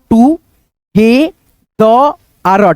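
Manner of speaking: "टू... हे... द... आर", each part apart with long gaps; broken into sounds. Shown as a demonstration. Teacher breaks down together for learners, sound by sound. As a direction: spell out "टू हे द आर" sound by sound. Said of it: "पढ़ेंगे दोस्तों".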